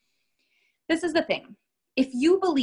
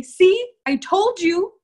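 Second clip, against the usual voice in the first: higher, much louder gloating; loud mock gloating See I told you; smug told-you-so; 9 semitones higher, 9 dB louder, much slower than usual. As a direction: crow it like you won the argument. Imitating gloating clients.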